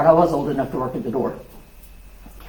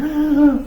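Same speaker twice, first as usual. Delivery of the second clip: put-on sharp high gasp of a scared child; startled gasp; 10 semitones higher than usual. Retelling a child's fright at a stranger, high gasp. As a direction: gasp like you just saw a stranger. Seven-year-old startled at night.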